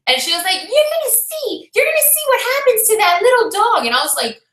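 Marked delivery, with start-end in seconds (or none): 0.6-3.8 retold screamed threat. Neighbour threatens the little dog, high and screaming. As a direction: pitch it way up like the menacing neighbour. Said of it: "screaming at me and threatening my dog".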